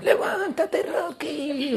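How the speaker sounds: high-pitched drawn-out mock yell of an old coach; imitated old trainer. Trainer urging Rocky to get up, high strained mock yell. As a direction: call out high, stretching each word. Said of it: "la voz de su entrenador".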